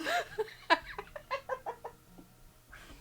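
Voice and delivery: high squealing laughter; high helpless laugh. Real, not acted. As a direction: burst into helpless giggles. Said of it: "can't do this with a straight face".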